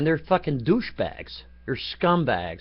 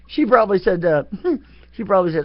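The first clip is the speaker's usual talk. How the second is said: laughing; 7 semitones higher, 7 dB louder, faster than usual. Real, not acted. Laughing while telling.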